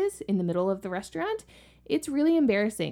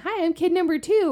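put-on high, louder chirpy kid hello; polite little kid greeting; 11 semitones higher, 7 dB louder than usual. Parent voicing how a normal kid says hi, high and chirpy. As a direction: greet a new playmate politely and brightly. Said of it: "instead of going up to them and saying".

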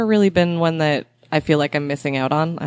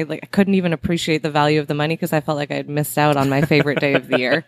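ordinary delivery

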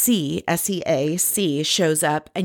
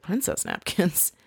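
soft, slow questioning repeat; imitated hushed disbelief, rising at the end; incredulous echo; 7 dB quieter than usual. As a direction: echo it quietly as a baffled question. Luann echoes it in disbelief.

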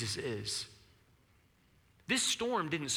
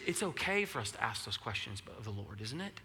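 ordinary talk

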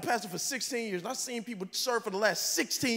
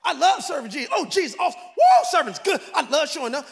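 higher and louder, gushing; mock excited volunteer; 5 semitones higher, 8 dB louder than usual. Pastor imitating an eager server, gushing loud. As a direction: whoop about serving.

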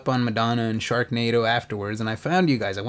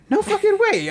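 high-pitched retold astonishment; mock disbelief; 3 dB louder than usual. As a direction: act out a friend's stunned reaction, pitched way up. Friend's disbelief, acted in story.

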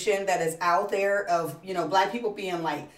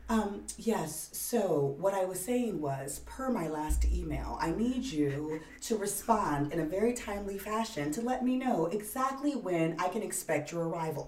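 professional office voice; 2 semitones lower, 9 dB quieter than usual. Retold or imitated. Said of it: "then you go to work and you're like".

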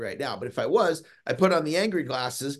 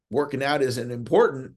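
ordinary talk, nothing marked

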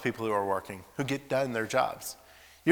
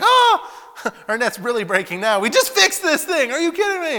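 much higher and louder, laughing in amazement; excited laughing shout; 13 dB louder than usual. Retold or imitated. Fishermen seeing the overflowing net, laughing excitedly. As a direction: shriek with delight as the net bursts.

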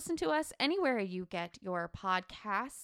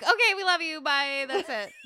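laughing; 7 semitones higher, 7 dB louder than usual. Pretend. Mock farewell to patrons.

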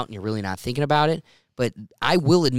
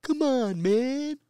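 Joe Biden impression; 13 semitones higher, much slower than usual. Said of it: "But then I got".

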